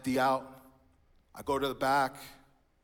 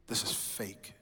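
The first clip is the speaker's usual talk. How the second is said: muttered scorn; 3 semitones lower, 4 dB quieter, slower than usual. Retold or imitated. Sulking in church balcony.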